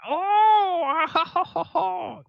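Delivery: acted falsetto whooping; high falsetto "ha ha". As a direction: let out a high, airy string of ha-has. Game master voices the musical scene.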